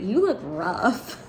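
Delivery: slow, mock-blunt verdict; blunt honesty. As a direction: imitate someone being bluntly honest, drawn out. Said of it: "He's just honest".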